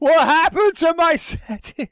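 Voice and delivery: high, slow, baffled laughing question; surprised. Real, not acted. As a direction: caught off guard, laughing. Menu suddenly in Chinese.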